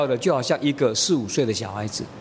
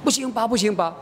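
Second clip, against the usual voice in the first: high-pitched imitation of a pleading girl; protesting child; 7 semitones higher than usual. Daughter hiding behind mother from father, protesting child. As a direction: refuse in a raised childish voice.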